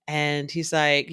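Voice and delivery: ordinary delivery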